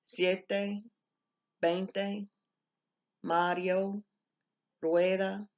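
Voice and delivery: careful Spanish word list, each word set apart; Spanish diphthong examples, one by one; reading examples aloud. Shown as a demonstration. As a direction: read each diphthong word out slowly and clearly. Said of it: "I've got some examples there".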